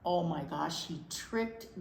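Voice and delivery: ordinary delivery, nothing marked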